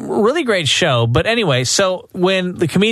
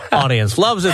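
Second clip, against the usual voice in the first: ordinary talk, nothing marked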